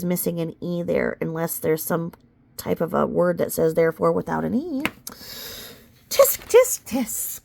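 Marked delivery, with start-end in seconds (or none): 6.1-7.4 tsk tsk like a prissy teacher; teasing disapproval, first tisk high; mock scolding. Scolding the lawyer's typo like an English teacher, mock tut. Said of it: "I should have been an English teacher".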